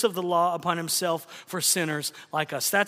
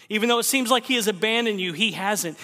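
urgent preaching; 3 semitones higher, faster than usual. Acted. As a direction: press it home urgently.

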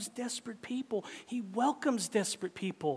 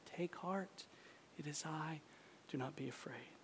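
much softer, hushed and tender; gentle; 4 semitones lower, 13 dB quieter than usual. Acted. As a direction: reassure softly, almost under the breath. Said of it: "Jesus will say to you".

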